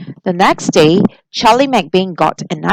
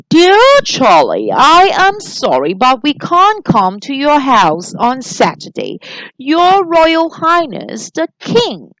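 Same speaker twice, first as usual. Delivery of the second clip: regal king voice; 6 semitones higher than usual. Acted. Reads the king's letter.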